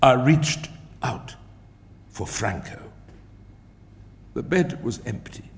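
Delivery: ordinary delivery, nothing marked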